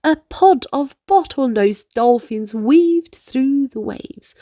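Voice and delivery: usual speaking voice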